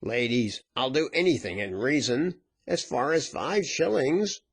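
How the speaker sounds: pleading, rising in pitch; lightly voiced anxious bargainer; begging to be heard. A narrator's reading. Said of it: "so he begged to be heard".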